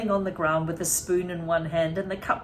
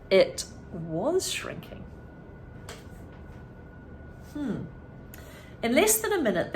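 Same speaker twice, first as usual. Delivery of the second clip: plain reading voice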